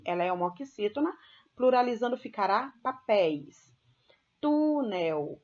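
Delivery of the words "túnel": "Túnel" with a long, emphatic TU; stress on first syllable. Shown as a demonstration. Teacher pointing out the stressed syllable in "Túnel", stress on TU. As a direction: punch and stretch the start of "Túnel", TU-nel. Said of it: "olha a sílaba tônica no tu".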